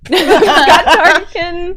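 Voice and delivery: high, slow words squeezed out through laughter; laughing echo. Real, not acted. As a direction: repeat it while cracking up. Echoing a friend, laughing.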